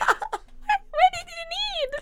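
hammed-up squeal, stretched out and laughing; seventeen octaves up. Clerk mimicking herself facing Debbie, absurdly high voice. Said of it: "my voice goes up seventeen octaves".

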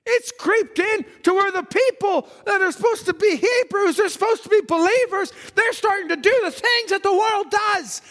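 rising, shouting preacher's warning; preaching at full force. Real, not acted. Preacher warns the church against the world, shouting. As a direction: hammer the warning home to the congregation.

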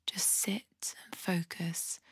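soft, unhurried body-scan voice with long pauses; guided meditation. Acted. Podcast host guiding listeners through a compassionate body scan, soft meditation voice. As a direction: guide them gently through the body, leaving space to breathe.

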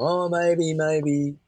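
high, vague "maybe" to dodge an answer; mock-evasive, drawn-out maybes; noncommittal stringing along. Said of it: "string people along".

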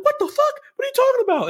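imitating a panicked mother, pitch shooting up; retold mom freaking out. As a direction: squeal it like a startled mom hearing of an earthquake. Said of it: "And she's freaking out".

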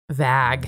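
"vag" with a short flat a, rhyming with bag; "vag" with the vowel of bag; vague as vag. Retold or imitated. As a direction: imitate a coworker's "vag" for vague. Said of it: "every time she says".

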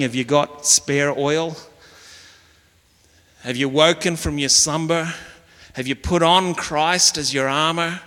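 ordinary talk

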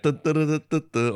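high, loud goofy sound effect voice; mocking sound effect. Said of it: "going like".

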